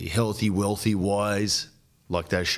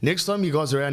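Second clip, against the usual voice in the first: ordinary talk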